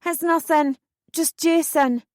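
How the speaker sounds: high call, trying to sound normal; forced normal voice. A narrator's reading. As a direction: call down, covering up panic. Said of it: "voice sound as normal as possible".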